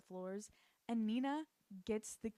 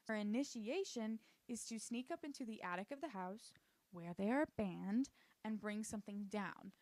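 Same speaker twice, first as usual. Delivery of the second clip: usual speaking voice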